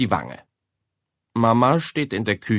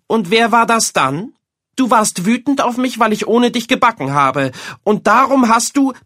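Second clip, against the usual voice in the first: higher, louder accusation; accusing mother; 12 semitones higher, 6 dB louder than usual. A narrator's reading. Mother accusing her son of revenge, angry. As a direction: pin the blame on him.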